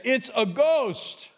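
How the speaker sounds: a touch of stretched-out fright; terrified disciples. Acted. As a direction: cry it out slowly in mild terror. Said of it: "they were terrified".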